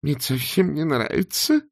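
moaning. A narrator's reading. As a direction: whine reluctantly, pitch up. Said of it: "простонал Артур". (жалобно постанывая)

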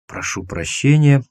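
plain reading voice

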